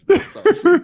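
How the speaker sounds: high delighted squeal; excited. Real, not acted. Delighted reaction, high and loud.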